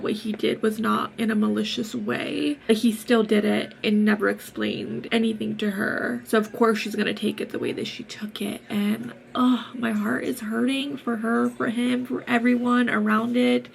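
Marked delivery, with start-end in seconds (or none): none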